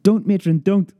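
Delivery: high, loud begging; boy faking pain, begging the matron loudly; pleading cry. A narrator's reading. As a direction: beg her to stop, crying out. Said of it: "I cried".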